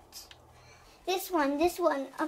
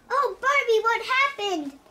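higher, louder worried call; alarmed; 6 semitones higher, 5 dB louder than usual. Acted. Ken calling to Barbie after her fall, alarmed.

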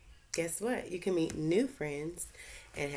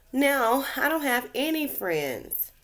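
higher, louder, slow tearful plea; mild sob; 9 semitones higher, 7 dB louder, slower than usual. Acted. The remora tells Gilbert she is friendless, mock sobbing. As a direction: let it wobble like a lonely little fish. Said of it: "She sobbed".

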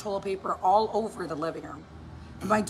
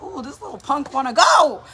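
loud, higher, amused bravado; mock fighting challenge; 4 semitones higher, 10 dB louder than usual. Mom sizing up her defiant toddler, mock challenge. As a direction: rise to a big finish, squaring up in jest.

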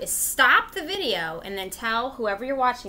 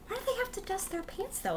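playful puzzled question; 10 semitones higher, 9 dB quieter than usual. Acted.

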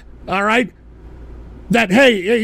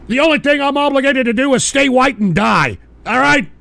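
normal talking voice

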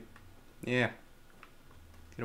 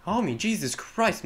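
loud, higher cry of alarm; startled shout; 6 semitones higher, 8 dB louder than usual. Real, not acted. Gamer shouting when his friend falls, startled. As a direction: yelp in shock as he falls. Teammate falls and breaks neck.

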